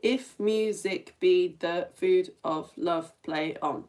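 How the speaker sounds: slow, separate syllables in "If music be the food of love"; syllable-by-syllable count. Shown as a demonstration. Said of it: "You can count them".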